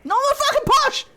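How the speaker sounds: high, loud hammed-up protest; mock indignant squeak. Answering the locals who call her posh, high mock squeak.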